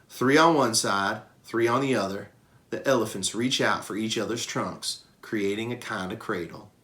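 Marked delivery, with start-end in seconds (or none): none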